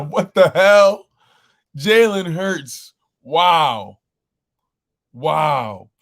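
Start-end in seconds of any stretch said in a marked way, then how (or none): none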